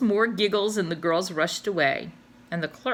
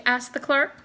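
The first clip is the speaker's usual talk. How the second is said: ordinary delivery, nothing marked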